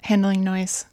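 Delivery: ordinary talk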